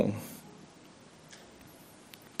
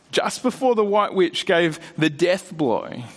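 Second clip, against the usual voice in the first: ordinary talk, nothing marked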